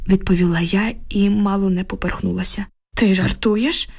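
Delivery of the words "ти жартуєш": mildly startled exclamation; a disbelieving question, without shouting; exclaiming. A narrator's reading. Julia hears the confession of murder, exclaims mildly. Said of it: "вигукнула Джулія".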